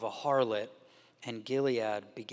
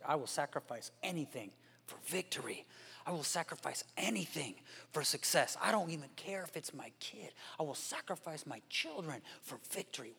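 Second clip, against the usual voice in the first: soft, almost whispered resolve; Jephthah's secret vow, hushed; hushed inner vow; 9 dB quieter than usual. Retold or imitated. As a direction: murmur the dark promise to yourself.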